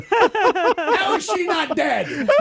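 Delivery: high, slow, laughing through the words; can't believe it, laughing; cracking up in disbelief. Real, not acted. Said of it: "laughing disbelief".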